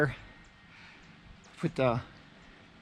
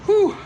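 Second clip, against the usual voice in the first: loud whew; 8 dB louder than usual. Real, not acted. Tired after mounting work.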